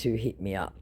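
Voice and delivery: usual speaking voice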